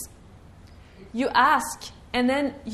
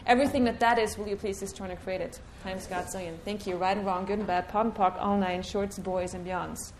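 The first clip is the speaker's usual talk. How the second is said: quick, hushed ritual recitation; softly rushed chanted formula; rattled-off clearing statement; 4 semitones lower, 4 dB quieter than usual. Acted. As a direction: murmur the formula fast like a rote incantation.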